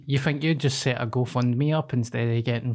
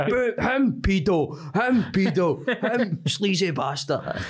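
mock accusing; 5 semitones higher, slower than usual. Pretends to call out names.

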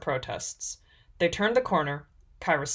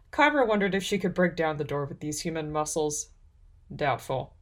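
ordinary delivery, nothing marked

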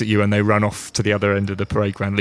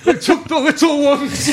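high, helpless laughing speech; cracking up; 4 dB louder than usual. Real, not acted. Game master losing it mid-scene, words broken by laughter. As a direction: squeeze the words out through a laughing fit.

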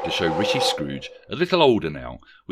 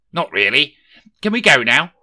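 louder and higher, whiny impatience; impatient; 7 semitones higher, 11 dB louder than usual. A narrator's reading. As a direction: whine to be let go. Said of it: "asked an impatient Rishi".

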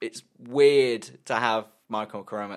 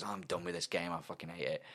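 softer, defeated grumble; muttered quitting; 6 semitones lower, 13 dB quieter than usual. Retold or imitated. Brother giving up on a game, muttered. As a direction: sulk it under your breath. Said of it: "just goes".

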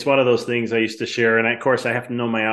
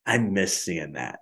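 lower, softer and slow, fondly missing it; wistful, softly; 5 semitones lower, 6 dB quieter, slower than usual. Retold or imitated. Chiropractor recalling his own thought while adjusting a patient, quiet and wistful. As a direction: let it sink in quietly and slowly.

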